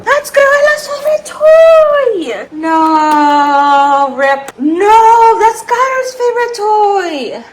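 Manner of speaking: high, loud, drawn-out wail of protest; dismayed cry. Real, not acted. Owner finding the dog's favorite toy wrecked, dismayed cry. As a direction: cry out in dismay, stretching the words.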